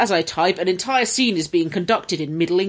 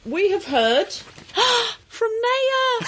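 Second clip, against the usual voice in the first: gasp, then a high, drawn-out wail; mock-dramatic announcement; much slower than usual. Host to co-hosts on a listener's news, mock-dramatic.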